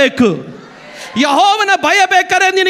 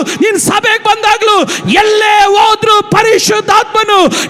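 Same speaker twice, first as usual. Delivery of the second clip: climbing sermon cry, higher with each clause; fiery preaching; 6 semitones higher than usual. Acted. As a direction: preach it out to the whole hall. Preacher building to full force.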